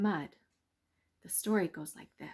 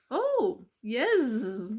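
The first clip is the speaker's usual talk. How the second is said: slow, loud sing-song bees; drawn-out happy bee chorus; buzzing bees; 4 semitones higher, 7 dB louder than usual. Acted. Bees teasing the mouse, slow and loud.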